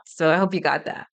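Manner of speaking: usual speaking voice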